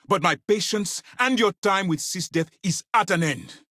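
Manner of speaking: high, heated final warning; angry dismissal. Acted. Officer throwing an agent out of SysDef, angry. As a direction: patience snapping, pitch well up.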